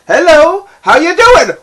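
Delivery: squeaky, very high, very loud cartoon greeting; cheery Spotify persona. Spotify arriving to greet the listener, squeaky loud cartoon voice. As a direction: burst in like an overeager new friend. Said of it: "He's like".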